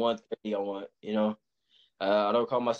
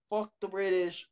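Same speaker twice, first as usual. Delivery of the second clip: mock British-hating indignation; 9 semitones higher than usual. Boxer imitating the Irish who hate the British, mildly mock indignant. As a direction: play an indignant Irishman, lightly. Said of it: "the ones that really hate the British".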